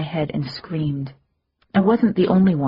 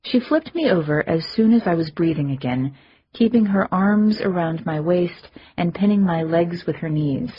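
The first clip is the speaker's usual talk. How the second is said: ordinary delivery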